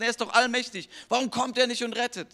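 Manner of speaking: preaching at full force. Acted. Preacher urging the congregation to find Jesus, fervent preaching. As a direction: proclaim it to the whole congregation.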